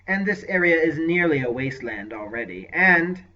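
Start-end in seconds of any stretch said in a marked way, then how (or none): 2.7-3.2 sly. Acted. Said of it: "he added in a sly voice".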